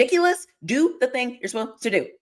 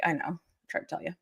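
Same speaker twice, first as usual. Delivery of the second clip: soft, low told-you-so; smug quiet; 6 semitones lower, 12 dB quieter than usual. Retold or imitated. Answering fans quietly with a told-you-so. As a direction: say it under your breath, smug. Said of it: "I'm like".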